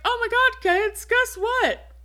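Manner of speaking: high, gushing dad voice; a high, giddy mock father; mock excited dad. Dad announcing engagement, mocked.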